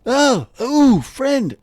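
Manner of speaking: high, wailing call that eases off; Urks calling out. Acted. Game master as Urks hailing a friend, high wail. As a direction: cry out high and loud, then soften on the last word. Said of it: "He's like".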